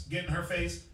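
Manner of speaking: ordinary talk, nothing marked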